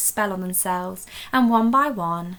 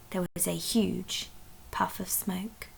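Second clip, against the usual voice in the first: softer whispered reveal; a secretive storyteller hush; hushed narration; 9 dB quieter than usual. A narrator's reading. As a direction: whisper the suspense.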